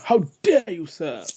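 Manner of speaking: high, loud mock outrage dropping away on the last word; Willy Wonka. Podcaster teasing his co-host, high theatrical indignation. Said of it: "Like Willy Wonka".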